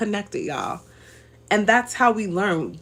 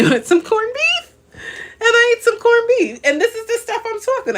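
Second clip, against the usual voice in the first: confessing through real laughter, pitched much higher; laughing; 3 dB louder than usual. Real, not acted. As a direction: giggle out the guilty confession. Laughing confession about corned beef.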